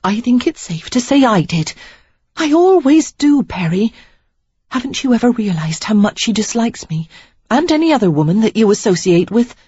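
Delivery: Sarah, indignant. Acted. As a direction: let her hurt boil over at him. Sarah's lines, higher voice.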